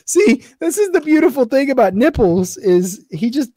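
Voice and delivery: high, laughing through the words; giggling while talking. Real, not acted. Laughing fondly about friend.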